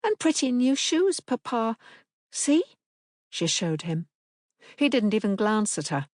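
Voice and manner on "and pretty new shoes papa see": put-on high, eager child voice; a small girl showing off; hopeful little girl. A narrator's reading. Daughter showing Papa her shoes, hopeful.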